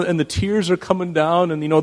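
usual speaking voice

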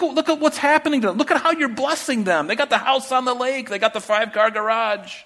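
high-pitched, exasperated mock lament; overwrought complaint. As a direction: plead to the Lord in hammed-up frustration. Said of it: "Asaph was so overwrought with this whole idea".

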